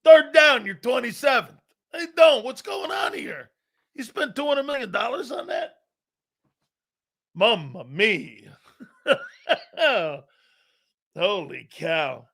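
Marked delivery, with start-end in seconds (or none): 0.0-0.6 loud high play call; announcer call. Real, not acted. Calling the game loud and high. 1.9-5.8 sharp high yell; shouting. Real, not acted. Yelling at the TV in disbelief. 7.4-12.3 slow laughing mamma mia. Real, not acted. Laughing slowly at the game replay.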